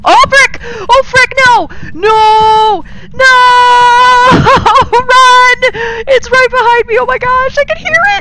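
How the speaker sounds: panicked screaming, very high and loud; being chased. Real, not acted. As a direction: scream in terror while fleeing. Ghost chasing the player.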